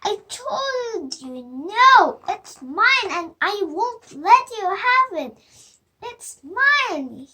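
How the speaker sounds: loud stamping-feet tantrum; angry. Acted. Little brother shouting at his sister in anger. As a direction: shout it like a furious little boy. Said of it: "Sam stamped his feet in anger".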